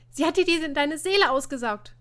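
loud, high outburst; excited interruption. A narrator's reading. Ina cuts in before Martin can finish, crying out. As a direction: cry it out over the other speaker. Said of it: "Doch Ina war mal wieder einmal schneller".

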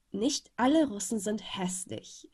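ordinary delivery, nothing marked

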